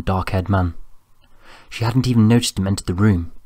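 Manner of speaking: plain reading voice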